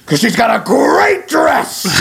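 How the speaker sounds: high, drawn-out hammy outburst; Al Pacino. Acted. Imagining a Heat-era actor in a fashion film, hammed-up. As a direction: explode slowly on each word like an overacting film star. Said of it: "if Heat era Pacino was in House of Gucci".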